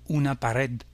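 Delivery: pared stressed on RED; Spanish pared, accented on its final syllable; stress on last syllable. Shown as a demonstration. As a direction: say una pa-RED, weight at the end. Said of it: "c'est la dernière syllabe qui est accentuée".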